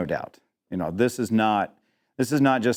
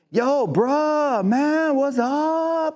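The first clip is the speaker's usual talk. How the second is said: much higher, exaggerated street accent; mock street accent greeting, high and playful; put-on slang accent; much slower than usual. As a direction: ham up a street accent, pitched way up. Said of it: "I do it in several accents".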